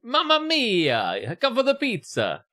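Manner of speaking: high, slow Italian chef cheer; hammed-up Italian pizza man; jolly italian pizza chef. Voicing the Italian manager.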